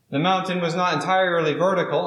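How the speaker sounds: ordinary delivery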